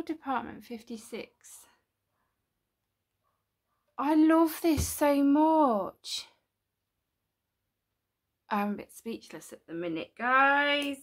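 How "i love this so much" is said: touched. Real, not acted. Admiring the gift.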